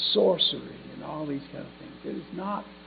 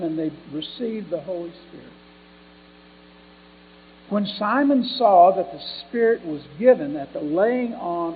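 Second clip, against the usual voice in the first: louder, emphatic pulpit reading; Scripture reading; 2 semitones higher, 10 dB louder than usual. Acted. Preacher reading Acts chapter eight to the congregation, emphatic Scripture reading. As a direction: read scripture out to the congregation, stressed words punched up.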